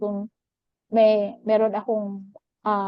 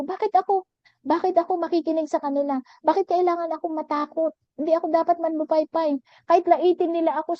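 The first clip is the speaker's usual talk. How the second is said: raised, pleading voice, rising higher; fervent protest; 6 semitones higher than usual. Retold or imitated. Praying to God, raised and fervent. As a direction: cry out to God against their insults. Defiant prayer against people's criticism.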